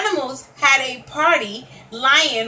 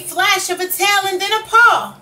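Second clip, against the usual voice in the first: excited build; 7 semitones higher, 4 dB louder than usual. A narrator's reading. Climax, lion finally roars.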